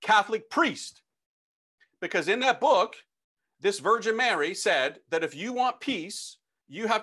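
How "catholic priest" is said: mild reenacted holler, pitched up; retold yell. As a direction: call out down an office hallway. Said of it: "and I yell out".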